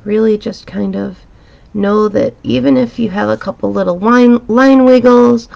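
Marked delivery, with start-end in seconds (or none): none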